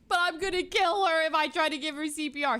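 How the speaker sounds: high, loud frantic protest; panicked wailing. Retold or imitated. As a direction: wail it in re-enacted hysteria. Retold panicked protest to friend.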